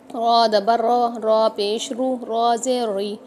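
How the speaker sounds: रॉ दबर रॉ spelled out clearly and loud, syllable by syllable; drill recitation. Shown as a demonstration. Teacher leads students through the ra vowel drill. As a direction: chant the vowel drill for practice. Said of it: "इसकी मशक करनी है".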